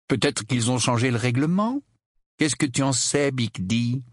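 teasing Harry. Acted. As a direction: bluff your cousin to unsettle him. (bluff moqueur, voix un peu haute)

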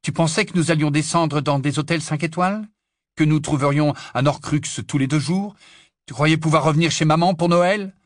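loud, high scornful questions; rising anger. Acted. Harry rounds on Ron over his expectations, angry. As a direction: fire off each question angrily, building. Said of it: "La colère venait à sa rescousse".

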